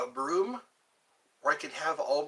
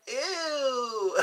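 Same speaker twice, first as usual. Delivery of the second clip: a short grossed-out ew, pitched up; disgusted ew; 7 semitones higher than usual. Real, not acted. Kid seeing mom and dad kiss, grossed-out ew. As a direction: recoil in disgust at the sight. Sees parents kissing on stream.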